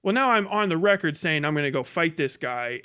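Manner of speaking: ordinary talk, nothing marked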